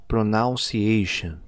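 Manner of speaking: put-on pronunciation drawn out slowly, the old wrong way; deliberately wrong pronunciation. Shown as a demonstration. Teacher showing students the wrong way, slow and drawn out. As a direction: say pronunciation slowly as a learner would. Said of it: "tenho falado ela errada de propósito".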